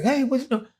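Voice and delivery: high, startled cry of who's there; high-pitched startled challenge; startled homeowner. Retold or imitated. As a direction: sound caught off guard by a stranger. Stranger startled by intruder.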